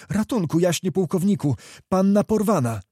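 high, urgent cry for help; breaking the news in acted alarm, pitched high; alarmed plea. A narrator's reading. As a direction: burst in with desperate news. Messenger bursts in begging for help.

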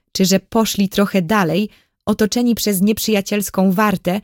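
usual speaking voice

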